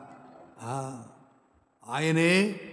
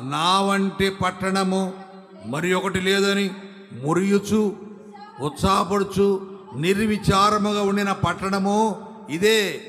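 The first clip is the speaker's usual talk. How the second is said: scripture reading; 5 semitones higher, 5 dB louder than usual. Acted. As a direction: read the verse out like a proclamation. Reads scripture aloud. (గంభీరంగా వచన పఠనం)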